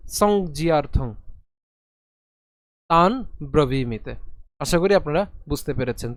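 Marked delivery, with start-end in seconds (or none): 0.1-4.2 সংজিয়ার্থং slow, saŋ-ji-ar-thaŋ; slow model reading; slow joined reading. Shown as a demonstration. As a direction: draw out সংজিয়ার্থং. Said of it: "একসাথে যদি উচ্চারণ করি".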